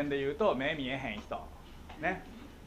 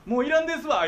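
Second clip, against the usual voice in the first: もういらないと訴える; 9 semitones higher, 9 dB louder than usual. Retold or imitated. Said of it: "言うてんのに".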